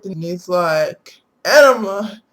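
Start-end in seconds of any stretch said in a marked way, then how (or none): 1.4-2.2 louder, blurted-out wrong word; blurted guess. Retold or imitated. Husband blurting a wrong drug name, loud and sure. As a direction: blurt it out with confidence.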